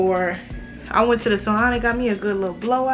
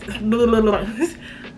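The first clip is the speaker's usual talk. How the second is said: embarrassed laugh. Real, not acted. Laughs at own lisp.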